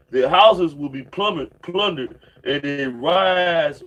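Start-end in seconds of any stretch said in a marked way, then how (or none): none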